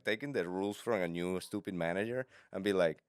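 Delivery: normal talking voice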